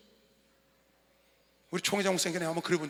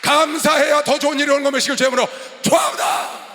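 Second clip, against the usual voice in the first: loud pulpit blessing; full-force preaching; 6 semitones higher, 13 dB louder than usual. Acted. Pastor blessing the congregation for thankfulness, shouted preaching. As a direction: proclaim it over the congregation at full voice.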